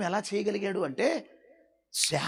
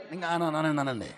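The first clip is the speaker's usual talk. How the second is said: ordinary delivery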